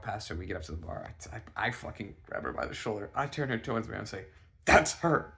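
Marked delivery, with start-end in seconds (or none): 4.7-5.3 high, loud, urgent outburst; urgent exclamation. Retold or imitated. Telling friend the crush is here, urgent. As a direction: blurt it out in alarm.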